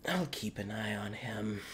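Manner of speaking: soft, low aside; quiet confiding aside. Acted. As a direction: lean in and murmur it. Claudius leans over confiding.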